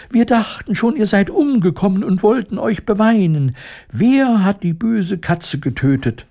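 very high-pitched mouse chatter; squeaking mice. A narrator's reading. Mice who feared their queen dead, high squeaking. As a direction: pipe up like agitated little mice. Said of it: "piepsten sie durcheinander".

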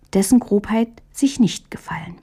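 ordinary delivery, nothing marked

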